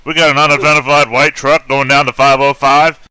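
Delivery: loud, high news bulletin voice; news reporter. Acted. Player cuts to the chase on the news, reporter voice. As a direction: punch it out like a live TV news report. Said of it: "watching this on the news".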